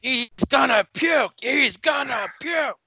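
high, drawn-out yell; alarmed shout. Acted. Co-host mimics a reaction to the gory wrestling spot, high alarmed shout. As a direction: cry it out in mock alarm, stretching each word.

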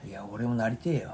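soft unvoiced whisper. Real, not acted. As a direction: murmur it under your breath. Whispered aside.

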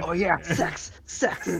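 goofy mock moan; lightly voiced fake moan. Joining the mock moaning.

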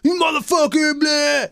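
high shouted insult; retold shouting. Angry husband curses at the closed door, high shout. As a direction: spit it out like a man in a rage. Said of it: "he's yelling at me".

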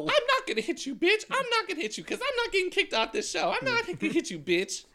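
high, loud taunting diva voice; hammed-up reality TV catfight taunt; mock reality star. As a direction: taunt like a reality-show fighter daring a hit. Acting reality show fight.

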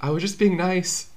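high-pitched, plaintive self-defense; mock protest. Imagined self protesting a restraining order.

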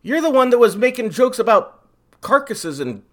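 high, loud indignant protest. Real, not acted. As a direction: push back, wounded and loud. Defending joke against co-host.

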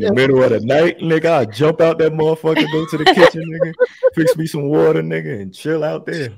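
laughing. Real, not acted. Laughing, joking about escaping.

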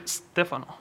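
STEFANO with a drawn-out initial S; no leading E. Shown as a demonstration. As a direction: start STEFANO straight on a long S, no vowel before it. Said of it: "para que no le ponga la E".

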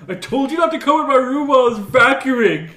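high, loud mock yell breaking into laughter; half-laughing pretend scolding.

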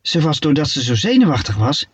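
usual speaking voice

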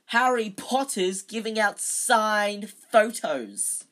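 slow, loud, high mocking announcement; roaring. A narrator's reading. Bully announcing Potter to the crowd, roaring. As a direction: proclaim it jeeringly to everyone. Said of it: "roared to the crowd".

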